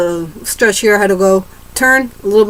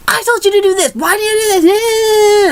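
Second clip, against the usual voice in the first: much higher, slow, whiny mock teacher; 12 semitones higher than usual. Student mocking a rude teacher with a whiny, high, slow voice. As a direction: whine it like a petty teacher, drawn out.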